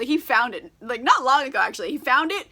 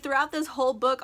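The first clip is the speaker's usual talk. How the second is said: ordinary talk, nothing marked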